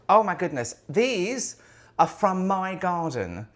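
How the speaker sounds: thrilled by the hydrangeas she grew herself, delighted; delighted gasp. Real, not acted. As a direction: gush over the flowers like you can't believe it. Delighted at her own hydrangeas.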